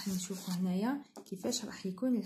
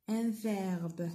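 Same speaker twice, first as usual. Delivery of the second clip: ordinary delivery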